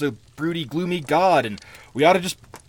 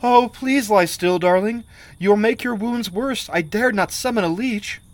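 put-on pleading girl; 5 semitones higher, 3 dB louder than usual. Girl bandaging soldier's wounds with trembling hands, gentle pleading. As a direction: coax him to lie still. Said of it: "begged the girl".

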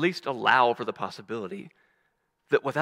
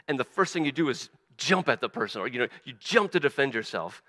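normal talking voice

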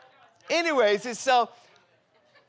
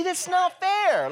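high, slow, whiny protest; mock whine; 10 semitones higher, slower than usual. Pastor mock-complaining about a vague text, high and whiny. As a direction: whine it like a sulking kid. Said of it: "I'm like".

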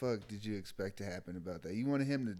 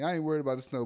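ordinary talk, nothing marked

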